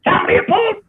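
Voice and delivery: very high imitated caller. Producer phoning the writer a second time, imitated very high. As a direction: call the name out high, in a put-on voice. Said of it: "And I hear".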